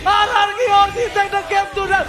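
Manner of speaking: slow, booming shouted victory call; fighting game announcer. Acted. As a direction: roar the win like an arena announcer. Kill-streak announcer call.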